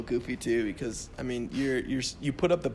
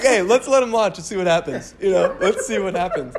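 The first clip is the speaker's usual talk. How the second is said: mocking controller; 5 semitones higher, 8 dB louder than usual.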